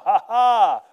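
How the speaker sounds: loud preaching with big ha-ha bursts; boisterous, deliberate belly laughs between shouted phrases; hearty laughing. Shown as a demonstration. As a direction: join her laughter loudly. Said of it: "she laughing out there".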